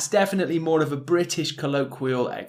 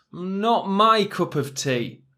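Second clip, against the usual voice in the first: slow model phrase; 4 semitones higher, slower than usual. Shown as a demonstration. Teacher models idiom slowly.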